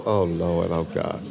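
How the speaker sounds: low, weary groan of a fed-up teacher; exasperated Jesus. Retold or imitated. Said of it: "Jesus picked those disciples and go".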